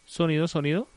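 loud sound check, rising at the end; prueba de sonido. Real, not acted. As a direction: call out a mic test. Probando el sonido de la emisora. (probando el sonido en voz alta)

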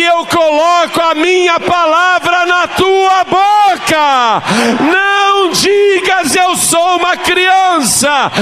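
slow, very high-pitched prophetic shout; preaching at full force. Acted. Preacher declares God's calling over the congregation, crying it out. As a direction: build to a drawn-out, soaring pulpit cry.

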